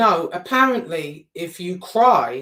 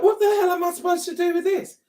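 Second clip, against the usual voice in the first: baffled; 10 semitones higher, faster than usual. Retold or imitated. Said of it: "So I'm like".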